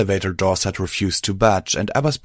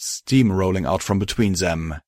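plain reading voice

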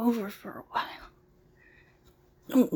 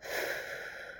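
long audible exhale; deep sigh; 13 dB quieter than usual. Shown as a demonstration. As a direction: breathe out slowly to show how. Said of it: "Take a deep breath".

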